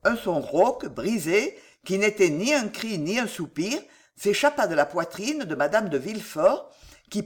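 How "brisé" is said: ordinary delivery, nothing marked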